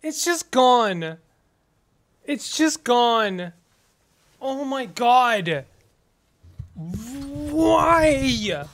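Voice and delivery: slow, high dismayed lament; stunned dismay. Real, not acted. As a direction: let the loss sink in slowly. Mourning lost items.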